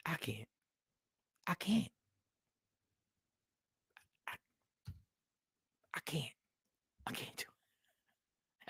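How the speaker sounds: barely voiced, worn-out woman giving up; defeated whispered I can't; drained whisper. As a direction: whisper it like she has nothing left.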